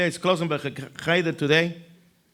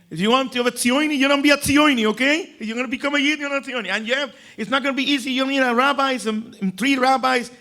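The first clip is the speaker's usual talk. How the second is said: put-on high, eager voice of lecturing kids; 8 semitones higher than usual. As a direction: rattle off the rules like know-it-all children.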